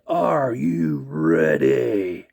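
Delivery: deep, slow stretched announcer call; slow deep hype call; drawn-out ring call. Retold or imitated. DX catchphrase drawn out.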